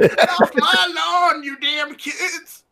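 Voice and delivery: put-on slow, laughing cranky old man; grumpy old man. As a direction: cackle it like an old coot.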